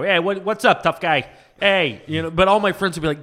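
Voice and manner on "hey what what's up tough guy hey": loud, higher prank-call tough guy. Retold or imitated. Fan imitating Jerky Boys character, tough guy. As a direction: shout out a cocky hello. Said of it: "the, the tough guy".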